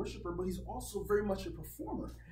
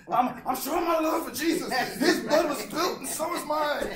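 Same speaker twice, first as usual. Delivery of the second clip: high, loud, laughing mock devotion; mock zealot; 8 semitones higher, 10 dB louder than usual. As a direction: ham up a self-harming zealot through laughter. Mocking a self-cutting worshipper.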